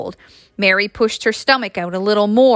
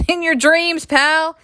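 laughing; 7 semitones higher, slower than usual. A narrator's reading. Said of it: "Mary laughed".

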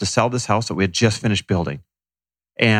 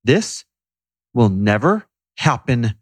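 slow, spaced-out vow; quoting a wife's determined declaration; resolute, meaning it; slower than usual. Retold or imitated. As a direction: pause after "This", then land it firmly. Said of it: "this different tone".

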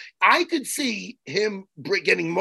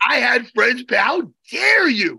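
higher and slow, hammed-up how dare you; mock indignant; 6 semitones higher, 3 dB louder, slower than usual. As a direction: play offended, overdone. Mock outrage at Jimmy.

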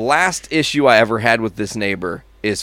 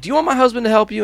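higher, quick friendly offer; chirpy neighbor lady asking fast; 9 semitones higher, much faster than usual. Retold or imitated. Lady neighbor offering help, high and quick.

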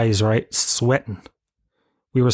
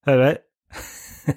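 short grunted Tralee hello; curt Tralee-style acknowledgement; Tralee nod greeting; 2 semitones higher, much slower than usual. Retold or imitated. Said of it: "Bit of a Tralee nod".

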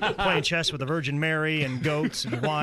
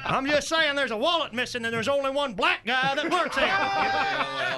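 higher-pitched accusing comic voice; raised, mock-innocent character voice; ignorant know-it-all; 11 semitones higher than usual. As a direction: insinuate like a clueless loudmouth. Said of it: "they get that look in their eyes".

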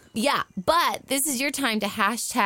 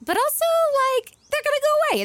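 squeaky; 4 dB louder, much faster than usual. Real, not acted. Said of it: "sorry for your ears".

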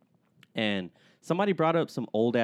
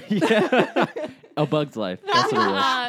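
laughing high and loud; giggling answer; 4 semitones higher, 6 dB louder, slower than usual. Real, not acted.

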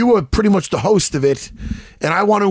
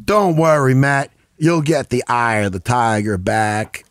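slow mock southern drawl; imitated southern drawl, sinking lower at the end; 4 semitones lower than usual. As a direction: draw it out like a southern consoler. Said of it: "In a southern drawl".

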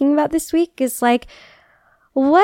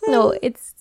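ordinary talk, nothing marked

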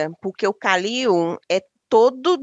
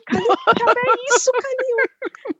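shocked scolding; 12 semitones higher, slower than usual. Retold or imitated. Mother scolding son.

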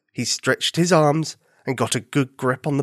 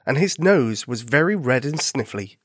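ordinary delivery

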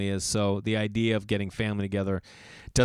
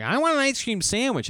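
put-on high whiny kid voice, pitched up; 12 semitones higher, 3 dB louder than usual. Kids at the farm stand begging dad for ice cream, whiny. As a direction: beg like a pouty little kid. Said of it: "my son and daughter are like".

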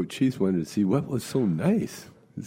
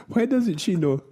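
put-on puzzled old lady; 12 semitones higher than usual. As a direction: ask it high and baffled.

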